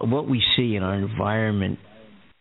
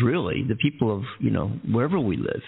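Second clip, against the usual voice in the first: ordinary talk, nothing marked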